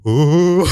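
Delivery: loud high hooting ooh; mock growl. The guy trying to sound scary, loud and silly.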